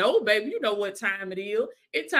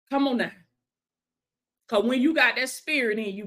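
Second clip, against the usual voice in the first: ordinary talk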